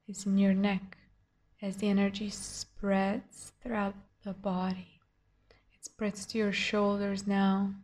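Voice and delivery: hushed and soothing, gently guide them inward; guided meditation. Acted.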